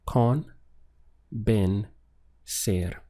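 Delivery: con, ben, ser split into separate beats; syllable by syllable. Shown as a demonstration. Teacher splitting a Spanish verb into syllables for learners. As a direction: break convencer into con, ben, ser slowly. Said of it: "So we have three syllables".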